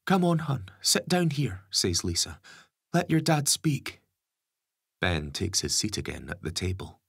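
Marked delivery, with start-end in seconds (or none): 0.1-1.6 higher gentle mother's voice; mum voice. Acted. 2.9-4.0 higher gentle mother's voice; mum voice. Acted.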